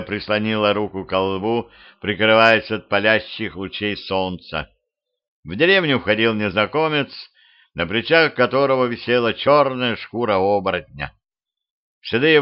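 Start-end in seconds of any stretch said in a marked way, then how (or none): none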